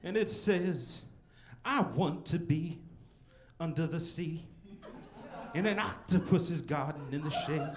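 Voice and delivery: a lightly done pulpit reading; pastor preaching. Retold or imitated. Pastor reading scripture to the congregation, slow preacher voice. Pastor reciting Beatles lyrics.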